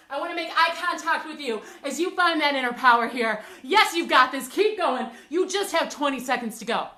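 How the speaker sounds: loud motivating push to the finish; rally them like a coach. Real, not acted. Coach pushing final seconds.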